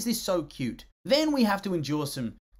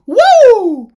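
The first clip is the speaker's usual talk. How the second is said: high, loud whoop; excited whoop; 19 dB louder than usual. Real, not acted. Reviewer whoops over the epic songs. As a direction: cheer it out loud.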